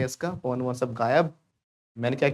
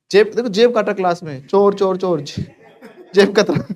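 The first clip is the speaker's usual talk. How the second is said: loud, high shouts of chor; mock thief alarm; 5 semitones higher, 8 dB louder than usual. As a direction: call out like spotting a thief in class. Teacher acts catching a pickpocket. (नाटकीय अंदाज़ में चोर चोर चिल्लाते हुए)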